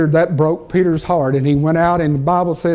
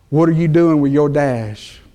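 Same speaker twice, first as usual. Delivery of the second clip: usual speaking voice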